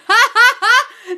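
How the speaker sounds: high, loud cackle of laughter. Real, not acted. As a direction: burst out laughing, high-pitched. Delighted at viewer's message.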